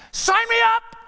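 high, loud burst; sudden joyful exclamation; excited cry. Real, not acted. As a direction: fling it out with joy.

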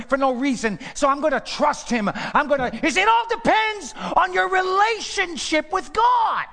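loud, rising sermon voice ending high; preacher building to a high loud peak; full-force preaching. Acted. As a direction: testify it with growing fire.

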